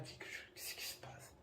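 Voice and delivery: hushed, puzzled whisper; whispered wonder. Retold or imitated. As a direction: breathe it out in disbelief. Surprise chuchotée devant le portable. (chuchotement intrigué)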